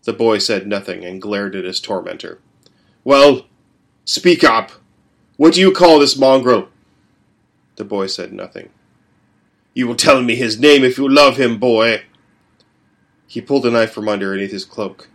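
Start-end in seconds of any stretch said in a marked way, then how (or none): 3.0-12.0 louder impatient bully; demanding father. A narrator's reading. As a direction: force the answer out of him. Father demands dog's name.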